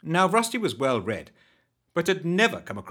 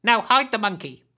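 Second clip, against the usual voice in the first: urgent hag order; 10 semitones higher, 6 dB louder than usual. Acted. Urgent order as footsteps near.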